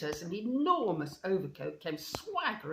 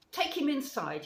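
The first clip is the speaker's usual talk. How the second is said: louder, higher stern command; stern order; 8 semitones higher, 5 dB louder than usual. A narrator's reading. Badger orders his companions, sternly. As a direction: give a firm, raised-voice order. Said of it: "he said sternly to his companions".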